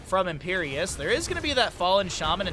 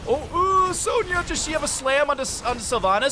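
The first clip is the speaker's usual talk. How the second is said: much higher, louder rush of suspense; tense excitement; 9 semitones higher, 4 dB louder than usual. Real, not acted. Caster wondering if Sonya lands the slam, tense. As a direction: hold your breath on the slam.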